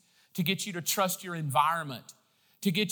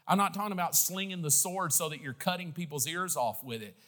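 usual speaking voice

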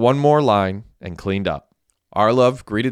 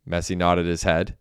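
ordinary delivery

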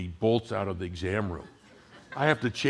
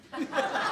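laugh. Real, not acted. Laughs after friend's quote.

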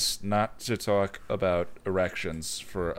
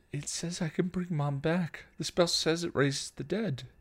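put-on begging teen; 7 semitones higher, 4 dB quieter than usual.

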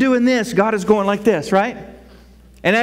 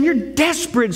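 usual speaking voice

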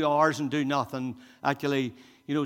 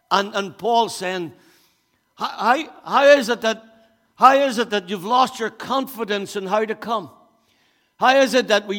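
raised, driving preacher's voice; preaching at full force; 5 semitones higher, 5 dB louder than usual. Acted. Pastor preaching hard to his church, loud and insistent. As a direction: press each question on the congregation.

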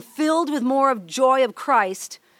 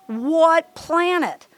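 very slow, drawn-out, higher-pitched disbelief; mock indignation; 4 semitones higher, much slower than usual. Wife complaining he wants out with friends, mock indignation. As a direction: stretch each word in acted outrage.

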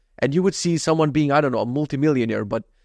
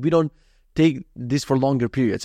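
ordinary talk, nothing marked

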